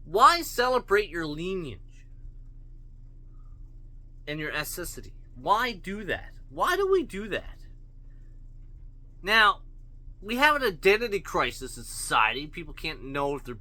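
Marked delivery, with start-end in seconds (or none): none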